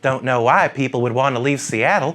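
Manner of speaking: mock the Seattle crowd like a fussy church lady, lightly put on. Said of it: "Let me try out my church lady impression".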